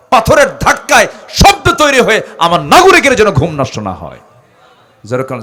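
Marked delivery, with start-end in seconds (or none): 0.1-4.2 loud, high rhetorical surge; impassioned preaching. Real, not acted. Preacher tells of Omar sparing citizens' sleep, impassioned. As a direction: drive the story home with force.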